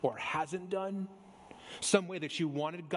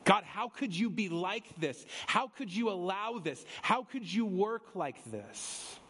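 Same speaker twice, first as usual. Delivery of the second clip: higher, indignant Jonah whining at God; complaining Jonah; 4 semitones higher than usual. Retold or imitated. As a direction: voice Jonah's accusing complaint, lightly acted. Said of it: "Jonah has essentially said all throughout".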